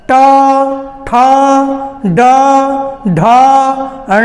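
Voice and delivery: ट, ठ, ड, ढ, ण each said alone, crisply; retroflex sounds demonstrated. Shown as a demonstration. Teacher sounding out retroflex letters for students. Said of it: "संभव नहीं है।".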